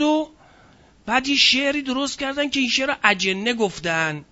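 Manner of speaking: normal talking voice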